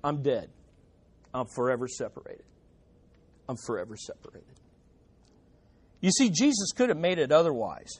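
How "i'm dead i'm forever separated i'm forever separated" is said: much softer and lower, falling away to near silence; hushed confession. Acted. Repentant sinner confessing before God, sinking to a hush. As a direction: let the words die away.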